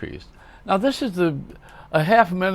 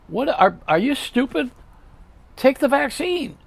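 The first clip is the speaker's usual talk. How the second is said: exasperated scolding; 8 semitones higher than usual. Real, not acted. Scolding anti-vaxxers.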